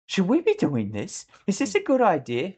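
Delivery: anxious doubt. Acted. Leader leaning over to his sniper, anxiously doubting. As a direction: ask it high, as an unsure leader.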